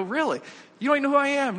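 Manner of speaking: high-pitched incredulous protest; retold disbelief. Defendant to man who lets him go, incredulous. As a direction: voice leaps up in amazement.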